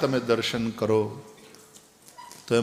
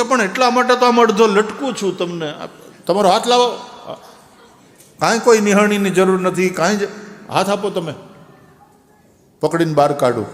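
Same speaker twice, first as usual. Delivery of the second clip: urgent pleading; 6 semitones higher, 7 dB louder than usual. Acted. Youth pleading at the well.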